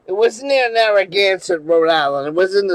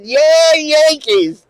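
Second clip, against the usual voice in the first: high, loud taunting cheer; provocative cheer; 8 semitones higher, 4 dB louder, much slower than usual. Retold or imitated. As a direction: cheer on purpose to needle the room. Cheering again to provoke bar.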